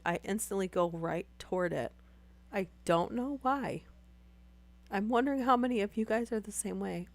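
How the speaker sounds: normal talking voice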